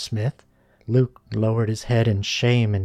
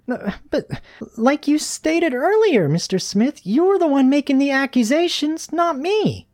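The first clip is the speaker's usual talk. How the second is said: put-on very high girl pupil voice; protesting schoolgirl; 4 dB louder than usual. A narrator's reading. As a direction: argue back in a high, insistent voice. Susie answers back at teacher.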